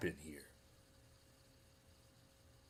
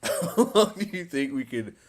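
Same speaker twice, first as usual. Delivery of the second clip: laughing; 5 semitones higher, 9 dB louder than usual. Real, not acted. Laughing at the long standoff.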